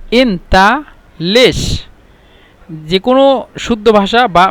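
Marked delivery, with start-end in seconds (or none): 0.1-1.9 ইনতা লিশ stretched very slowly, each sound held long; slow it right down so learners catch ইনতা লিশ; drawn out slowly. Shown as a demonstration. Teacher slowing ইনতা লিশ down for students, very slowly. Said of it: "ওরা এভাবে বলতে পারে".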